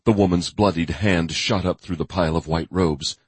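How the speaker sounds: ordinary delivery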